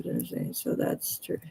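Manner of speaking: whispered spelling under the breath; muttered to self. Real, not acted. Typing her email address, whispering it to herself. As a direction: mouth the letters to yourself while typing.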